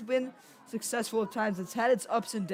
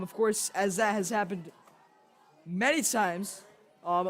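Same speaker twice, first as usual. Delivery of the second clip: ordinary talk, nothing marked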